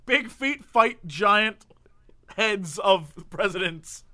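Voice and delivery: drawn-out, slow epic build-up; a mock-grand prophecy, slow and weighty; slow dramatic pronouncement. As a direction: say it slowly like a movie trailer. Mock apocalypse announcement.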